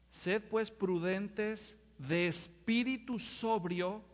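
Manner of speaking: slow, measured words; slow scripture reading. Shown as a demonstration. Preacher reads Peter's counsel slowly and deliberately. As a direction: weigh each word of the verse. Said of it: "reading scripture slowly".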